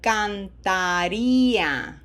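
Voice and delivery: slow Spanish drill, each vowel stretched; slow repeat-after-me. Shown as a demonstration. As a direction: model it slowly for students to echo. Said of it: "Repeat after me. Out loud".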